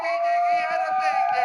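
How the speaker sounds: high screeching shout; high pitched shriek of surprise; excited yell. Acted. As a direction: shriek the punchline.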